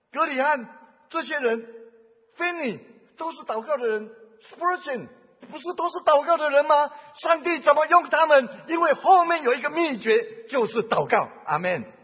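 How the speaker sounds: loud, rising sermon climax; building up to a shouted sermon peak; preaching at full force. Acted. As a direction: drive the point home to the congregation.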